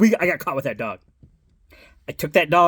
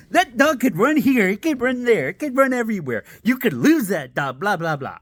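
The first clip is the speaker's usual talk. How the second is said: higher nagging singsong; preachy lady; 6 semitones higher than usual. Retold or imitated. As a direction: list every danger with a nag. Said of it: "She's like".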